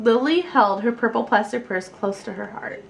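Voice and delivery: ordinary delivery, nothing marked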